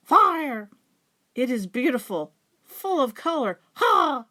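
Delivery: high, loud, thrilled announcement; excited raven. A narrator's reading. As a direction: burst out with the big news. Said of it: "he cawed".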